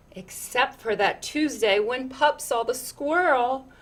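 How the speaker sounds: lilting, rhythmic read-aloud; picture book reading. Acted. Reading a picture book to children, lilting storyteller voice.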